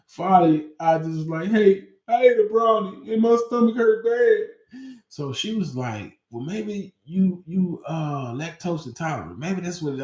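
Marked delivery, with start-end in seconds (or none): 1.5-4.5 much higher and louder, whiny; desperate confession. Retold or imitated. Student finally confessing the brownie to the nurse, much higher. 6.3-10.0 a hint of a puzzled nurse; lightly voiced nurse.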